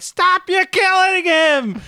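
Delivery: shrill, high screaming mother; shrill mom scream, stretched out; George's mom. Retold or imitated. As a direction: shriek it high and drawn out. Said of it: "the shrill screaming of his mom's voice".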